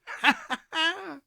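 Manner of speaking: high, breathy rising laugh; splendid laugh. Acted. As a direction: let out a giddy cackle. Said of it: "it was a splendid laugh".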